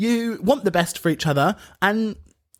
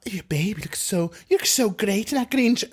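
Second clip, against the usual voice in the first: badly faked Welsh accent; terrible Welsh accent; 2 semitones higher than usual. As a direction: attempt a Welsh accent, not quite landing it. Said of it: "that was terrible Welsh accent".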